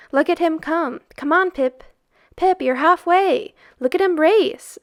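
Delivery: loud, high cheering. Acted. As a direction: urge him on from the sidelines. Boys cheering Pipkin's run.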